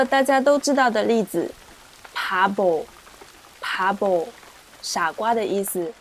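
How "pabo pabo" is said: drawn-out pabo, first syllable pa like p, second bo like b; slow pronunciation demo. Shown as a demonstration. Teacher showing students the word pabo, very slow. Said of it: "前一个听上去更加偏向于p".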